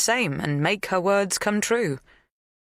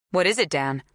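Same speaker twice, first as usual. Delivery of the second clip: sharp; 2 semitones higher than usual. A narrator's reading. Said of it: "Zoe's voice was sharp".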